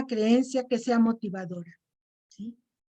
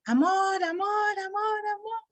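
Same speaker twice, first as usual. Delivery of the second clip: echo; 9 semitones higher than usual. Shown as a demonstration. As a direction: mimic the echo dying away. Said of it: "el eco me va a responder".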